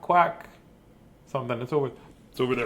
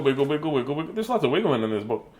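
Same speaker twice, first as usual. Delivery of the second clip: ordinary delivery, nothing marked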